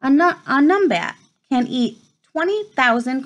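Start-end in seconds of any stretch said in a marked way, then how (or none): none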